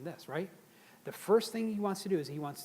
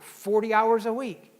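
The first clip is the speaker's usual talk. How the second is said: blurted out; 5 semitones higher, 11 dB louder, slower than usual. Retold or imitated. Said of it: "that guy blurted out".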